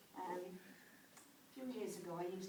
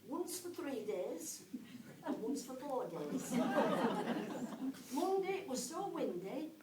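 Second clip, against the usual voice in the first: higher, louder recital of a letter; comic letter reading; 4 semitones higher, 9 dB louder than usual. Acted. Mum writes a silly letter to her son, comic letter reading. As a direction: read it out line by line, pausing for each punchline.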